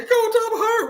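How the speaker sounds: put-on whiny hurt kid. Player complaining to coach.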